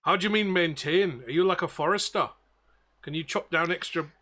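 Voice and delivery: captain questioning in shaky-quality Belfast; bad Belfast accent. Captain querying the crewman's offer, bad Belfast accent. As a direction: put on a poor Belfast accent. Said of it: "absolute worst Belfast accent I've ever done".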